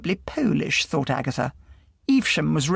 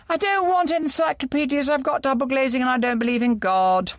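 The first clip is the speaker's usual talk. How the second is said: sharp and much higher, a hostile doorstep rebuff; harsh; 9 semitones higher than usual. A narrator's reading. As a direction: bark it at the stranger to get rid of them. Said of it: "she said harshly".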